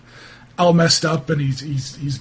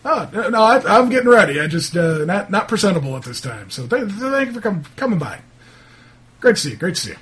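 higher, stammering, obliging shopkeeper; lightly flustered, eager-to-please townsman; 3 semitones higher, faster than usual. Acted. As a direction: hurry visitors off politely.

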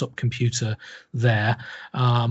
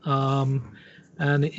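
ordinary talk, nothing marked

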